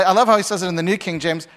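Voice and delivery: ordinary talk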